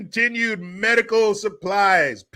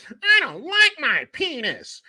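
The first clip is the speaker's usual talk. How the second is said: higher, slow whiny complaint; whiny put-on voice; 8 semitones higher than usual. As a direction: whine it like a sulky kid.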